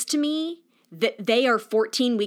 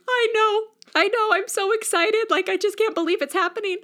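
much higher, overwhelmed joy; tearful excitement; 11 semitones higher than usual. Retold or imitated. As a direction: mom crying with excitement. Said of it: "My mom bursts into tears".

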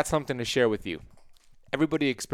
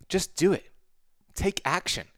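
emphatic push; 6 semitones higher than usual. Real, not acted. Urges listeners to act.

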